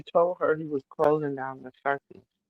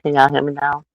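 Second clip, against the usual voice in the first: louder to be heard; 9 dB louder than usual. Real, not acted. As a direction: project over a weak mic. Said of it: "I can barely hear you".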